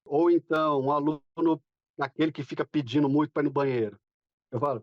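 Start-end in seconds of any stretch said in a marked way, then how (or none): none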